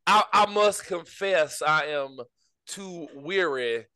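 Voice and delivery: put-on slow, languid Southern plantation accent; mock Southern gentleman. Joking about the Daytona race, in a slow mock Southern accent. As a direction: sigh it out like a weary old belle. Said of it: "that stupid accent that I was doing".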